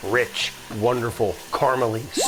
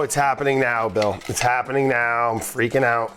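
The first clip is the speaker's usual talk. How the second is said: ordinary talk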